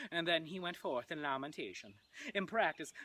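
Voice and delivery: ordinary talk, nothing marked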